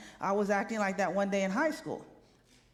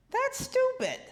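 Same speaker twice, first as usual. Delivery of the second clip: indignant; 14 semitones higher, slower than usual. Real, not acted. Indignant reply.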